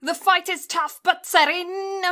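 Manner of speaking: high-pitched flourish, lingering on the name; high, theatrical announcement; odd dramatic flourish. Real, not acted. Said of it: "don't know why I said it like that".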